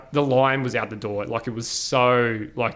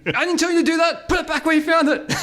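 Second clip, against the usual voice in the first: scolding auntie; 4 dB louder than usual. Retold or imitated.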